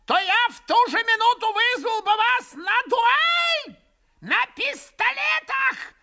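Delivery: put-on shrieking high old man, slow and loud; furious old man. A narrator's reading. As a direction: stamp and shriek the challenge. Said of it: "кончил он, топая обеими ногами".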